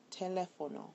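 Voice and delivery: LÉ stressed in teléfono; stress on second E. Shown as a demonstration. Teacher shows a word that breaks the rule, teléfono stressed on LÉ. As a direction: say teléfono with the weight on its second syllable, LÉ. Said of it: "it's actually on the second E".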